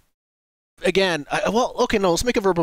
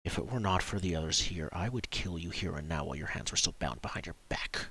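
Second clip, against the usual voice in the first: low, hushed menace; soft, much lower voice, for one listener only; whispered threat; 6 semitones lower, 10 dB quieter, faster than usual. Acted. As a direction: threaten him privately under your breath. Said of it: "he whispers to him".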